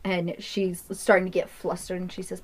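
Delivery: usual speaking voice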